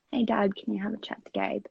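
hushed whisper to dad. Retold or imitated. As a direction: breathe it so Gabe won't hear. Asking dad to step in.

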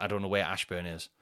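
normal talking voice

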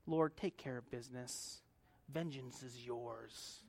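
soft surrendering prayer. Pretend. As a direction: hand it over to the Lord softly. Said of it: "just saying".

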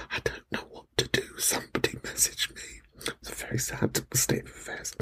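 whispered, half-crying plea; mock whimpering whisper. Host acting out being lost without messages, whispered mock cry. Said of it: "being like".

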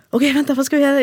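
high, flustered questions; jolted awake and panicking; panicked partner. Retold or imitated. Husband woken by the news, asking in panic.

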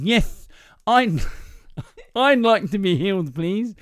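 put-on impeded speech, high and loud; comic man with a speech impediment. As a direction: talk through thick, muddled consonants. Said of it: "the guy sounds deaf".

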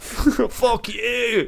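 lightly put-on London bloke; London geezer accent. Dead granddad swearing back at grandson, light London accent. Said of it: "I'm from fucking Brixton".

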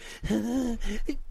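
mock exhausted panting; exaggerated puffing for breath. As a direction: imitate someone winded from falling, high gasps. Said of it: "She's all out of breath".

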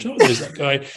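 high, bright, laughing pop-star voice. Pretend. An imagined pop star spotting him on TV, high and giggly. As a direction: chirp it up, half laughing.

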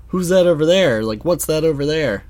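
put-on old distraction trick. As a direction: shout it out like the classic trick to make him turn around. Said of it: "with the old".

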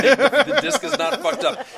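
laughing. Real, not acted. Laughing at the good disc.

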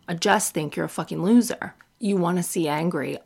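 ordinary talk, nothing marked

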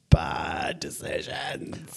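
very slow, stretched whisper; drawn-out whisper. Real, not acted. Admitting wild younger days to friends, slow whisper. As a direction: whisper it slowly for comic confession. Rueful confession of past dating.

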